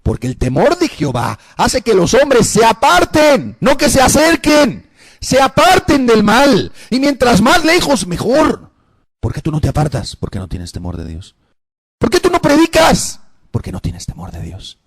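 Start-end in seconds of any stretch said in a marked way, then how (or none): 0.0-8.7 loud, high, drawn-out exhortation; preach. Real, not acted. 12.0-13.2 high, loud challenge; preaching shout. Real, not acted. Preacher challenges congregation at full force.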